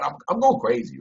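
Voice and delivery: normal talking voice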